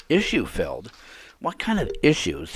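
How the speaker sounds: incredulous. Real, not acted. Incredulous repeat of emailer's word.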